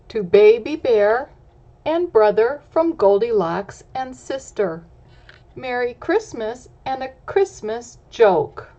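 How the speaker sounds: reading a card aloud with a lift. A narrator's reading. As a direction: read the card out to the kids.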